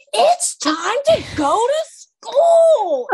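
high, slow, drawn-out exasperated yell; snapping mom. Retold or imitated. Mom who flipped, yelling at kids to leave, high and slow. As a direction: lose it at the kids, dragging each word. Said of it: "I, like, flip from".

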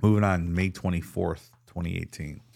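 usual speaking voice

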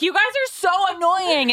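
high, mock-annoyed whine; annoyed mom. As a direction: act out a fed-up mom. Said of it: "I'm like".

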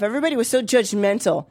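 usual speaking voice

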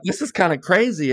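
high, nervous mock panic; retold fright. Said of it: "scared shitless".

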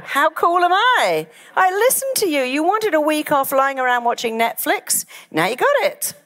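high-pitched, pleased-with-itself mind; gleeful boast. Acted. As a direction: crow proudly at granting a wish. Mind boasts of granting the wish.

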